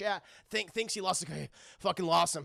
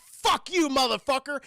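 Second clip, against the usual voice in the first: loud, high, acted outburst; road rage; 6 semitones higher, 6 dB louder than usual. Charlie at the driver who cut him off, raging. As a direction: yell it like a driver snapping. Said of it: "a little bit of case of road rage".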